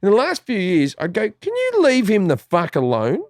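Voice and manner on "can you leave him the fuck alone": raised-pitch mock exasperation; exasperated. Son telling his mother to let dad speak, exasperated. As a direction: imitate pleading on someone's behalf.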